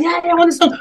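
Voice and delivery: high mock-girl voice; eager requester. Girl insisting to the DJ on her song, high imitation. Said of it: "She's like".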